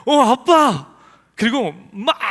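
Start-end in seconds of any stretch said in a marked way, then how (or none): 0.0-0.9 put-on high, loud, delighted call of a child; thrilled six-year-old calling to his father; excited child. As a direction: sing out to dad like a thrilled kid. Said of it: "이거예요".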